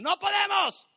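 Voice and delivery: high, loud cry of defeat; despairing shout. Acted. Preacher voicing the scared crowd, shouting in despair. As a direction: yell it like a crowd giving up. Sermon, acting despairing people.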